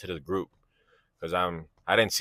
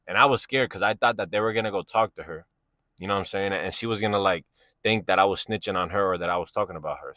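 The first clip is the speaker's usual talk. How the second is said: ordinary talk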